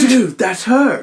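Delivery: high, drawn-out skater-dude exclamation; excited Matt impression. Imitating Matt at the Santa Monica park, slow excited cry. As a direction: echo the awestruck yell, stretched out. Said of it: "what does Matt say when they first get".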